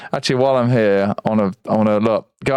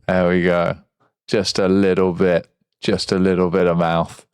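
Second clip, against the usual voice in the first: deep voice; 7 semitones lower than usual. Shown as a demonstration. Playing with deep voice changer.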